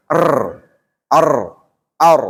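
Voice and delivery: ordinary talk, nothing marked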